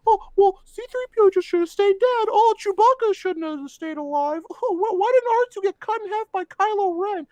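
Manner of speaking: poking fun at fans who gripe about survivors; mocking whiny fans. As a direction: parody the complainers, sing-song and prissy. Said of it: "And everyone's like".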